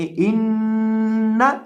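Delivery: इन्ना with a long nasal hum on the doubled noon; nasal ghunna. Shown as a demonstration. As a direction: hold the noon in इन्ना through the nose. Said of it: "इन्ना के ऊपर गुन्ना करके पढ़ेंगे".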